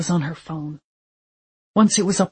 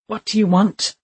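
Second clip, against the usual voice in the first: hoarse croak, a little higher; 3 semitones higher, 3 dB louder than usual. Acted. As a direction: croak out the question weakly. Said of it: "Jason croaked".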